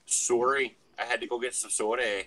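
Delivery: lightly put-on Canadian accent; mock Canadian accent. Teasing friends about getting soda, mock Canadian accent. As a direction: joke Canadian voice with a tagged-on "eh". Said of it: "That's my Canadian accent".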